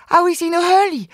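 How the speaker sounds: little kangaroo squeaking with agitation; agitated. A narrator's reading. Little kangaroo questions the wombat about the cave, agitated. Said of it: "hüpft vor lauter Aufregung ständig hin und".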